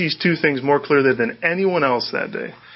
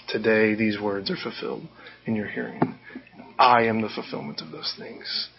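softer, lower quoted pronouncement; understated, low and soft; quiet declaration; 4 semitones lower, 7 dB quieter than usual. Retold or imitated. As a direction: hush down for a solemn announcement. Jesus quietly declaring in synagogue.

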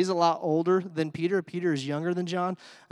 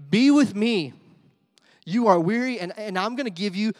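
fervent preaching; 5 semitones higher, 6 dB louder than usual. Acted. Preaching Jesus's invitation at full force.

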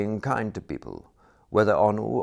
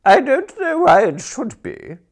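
much higher and louder, a sharp tart retort; tartly; 12 dB louder than usual. A narrator's reading. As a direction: cut him down with acid. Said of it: "she said tartly".

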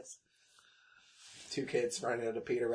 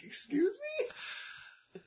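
offended; 4 dB quieter, much slower than usual. Acted. Offended reply to rude customer.

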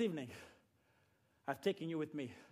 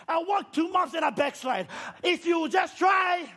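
loud, rising preacher's exhortation; preaching at full force; 6 semitones higher, 5 dB louder than usual. Real, not acted. Preacher urging the church to try, full-force preaching. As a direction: drive it home to the congregation.

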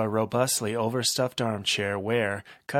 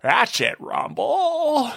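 high purring villain; 14 semitones higher, 4 dB louder, much slower than usual. Acted. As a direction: coo it like a pleased cat. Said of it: "Gentleman purred".

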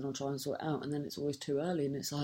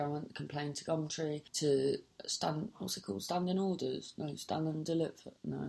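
usual speaking voice

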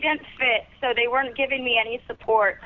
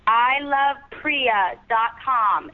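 slow and clear; much slower than usual. Shown as a demonstration. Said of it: "One more time, what's the web address?".

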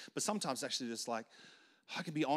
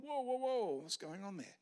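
startled whoa; 8 semitones higher than usual. Retold or imitated. Colleague startled by a teammate's angry outburst, high surprised whoa. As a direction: pull back, surprised, then ask gently.

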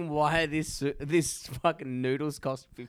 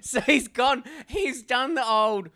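much louder and higher, laughing; 8 semitones higher, 10 dB louder, slower than usual. Real, not acted. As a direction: roar with laughter at the reveal. The punchline finally lands.

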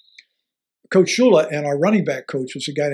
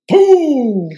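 mock boom; 10 semitones higher, 8 dB louder than usual. Imitating punt hitting ball.

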